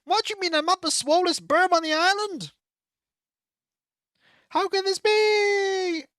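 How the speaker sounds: high, incredulous character voice; high-pitched hammed-up amazement; astonished villager. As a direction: wail in mock disbelief, stretching the end.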